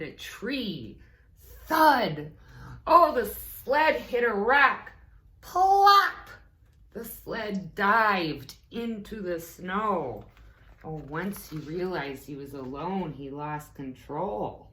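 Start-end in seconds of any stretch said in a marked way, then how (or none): none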